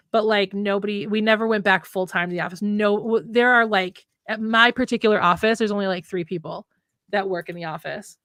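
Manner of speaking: ordinary delivery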